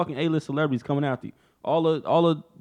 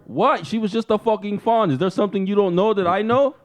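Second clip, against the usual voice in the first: high, mock-indignant pleading; defensive husband; 8 semitones higher, 3 dB louder than usual. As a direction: argue back in a hammed-up quarrel. Acted quarrel as defensive Conor.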